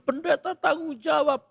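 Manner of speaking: high-pitched mock accusation; imitated distressed demand. Stressed mother blames the pastor, imitated high voice. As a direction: mimic an upset mother without full crying. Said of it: "ibunya stres, nangis".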